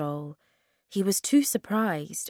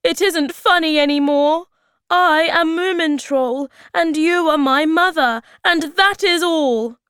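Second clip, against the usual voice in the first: high, loud, exasperated insistence; crying out; 12 semitones higher, 10 dB louder than usual. A narrator's reading. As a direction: insist desperately at full voice. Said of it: "cried Moomintroll".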